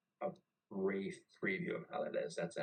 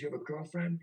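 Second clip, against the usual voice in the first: high-pitched mocking question; raised-pitch retold taunt; incredulous jeer; 8 semitones higher, 4 dB louder than usual. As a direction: imitate a chief in scornful disbelief. Chief mocking him during berating.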